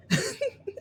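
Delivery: a quick, high burst of laughter; short laugh. Real, not acted. Laughing while retelling her father's hard question. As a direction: laugh it off, looking back. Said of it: "I can laugh today".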